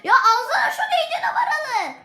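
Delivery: high, loud, fast laughing-crying yell; frustrated shout. Real, not acted. Gamer yelling at his team, high laughing-crying shout. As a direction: scream at the useless team.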